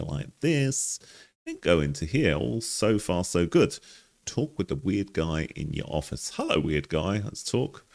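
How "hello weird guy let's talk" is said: ordinary delivery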